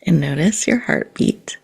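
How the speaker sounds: guided meditation. Acted. Host guiding listeners to their heartbeat, calm meditation voice. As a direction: ease the listeners into calm, one phrase at a time.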